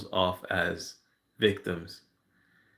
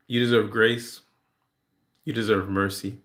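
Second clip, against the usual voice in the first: ordinary talk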